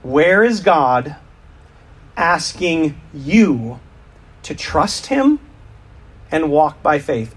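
slow, spaced-out question; slow reflective. Real, not acted. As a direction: let each phrase land with pauses.

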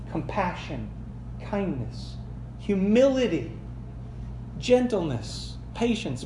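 soft list. Shown as a demonstration. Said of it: "Did you hear the echo".

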